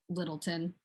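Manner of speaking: "Littleton" low and soft, ending reduced to -tən; local pronunciation. Shown as a demonstration. Showing how locals call it, "Littleton" with a reduced ending. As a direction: say "Littleton" quietly with an unstressed "tun" ending. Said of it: "That's what the locals call it".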